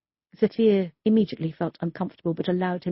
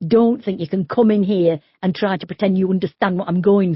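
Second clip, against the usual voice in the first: loud, bitter tirade; angry rant; 2 semitones higher, 6 dB louder than usual. Acted. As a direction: throw the scorn right at her. Grieving man attacks the officer.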